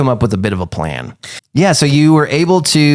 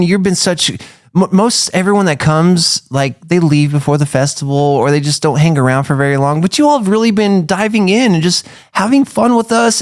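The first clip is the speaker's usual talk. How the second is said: higher-pitched, heartfelt thanks; grateful town dignitary; 7 semitones higher than usual. Acted. Important townsperson thanking the visitors, raised grateful voice. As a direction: gush appreciation in a raised voice.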